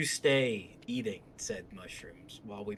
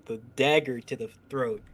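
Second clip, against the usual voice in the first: ordinary delivery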